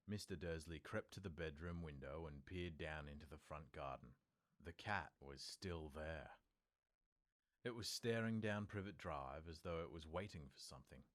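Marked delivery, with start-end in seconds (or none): none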